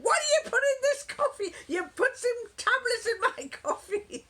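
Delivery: high, loud accusing voice with laughter; mock indignant husband. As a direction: play the outraged husband, half laughing. Husband accusing her, retold.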